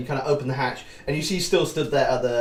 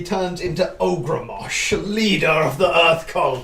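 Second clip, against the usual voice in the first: slow, louder villain announcement; dramatic reveal; 5 semitones higher, 6 dB louder, slower than usual. Acted. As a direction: unveil the cult leader slowly.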